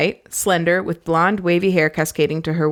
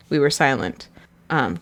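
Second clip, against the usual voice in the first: ordinary delivery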